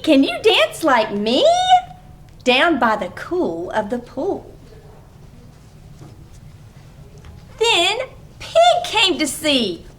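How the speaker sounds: put-on bright read-aloud for small kids; lively story reading. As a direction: read it like a playful picture-book story time. Reading picture book aloud.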